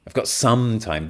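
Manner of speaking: "some" emphasized and lengthened, hinting be quick; stress on some. Shown as a demonstration. Answering that time is short, stress on "some". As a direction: lean on "some" to limit it. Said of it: "The message here is like, "Be quick".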